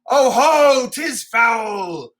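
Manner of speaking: shout, then crack on the oh ho; wounded old man's shout at the sky; bitter raging king. Acted. Lear raging at the storm.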